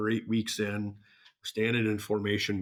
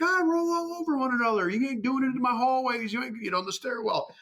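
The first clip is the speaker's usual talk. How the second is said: much higher, loud barked orders; drill sergeant yell; 4 dB louder than usual. Retold or imitated. Drill sergeant yelling at recruits.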